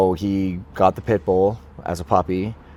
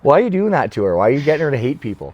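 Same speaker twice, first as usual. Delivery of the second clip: higher-pitched, exasperated complaint; retold protest; 5 semitones higher than usual. Retold protest to his dad.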